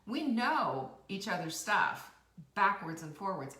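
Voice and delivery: normal talking voice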